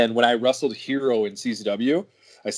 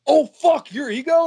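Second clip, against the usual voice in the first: very high, louder excited outburst; starstruck fan; 5 dB louder than usual. Retold or imitated. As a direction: imitate a fan's shocked recognition. Fans recognize him, retold.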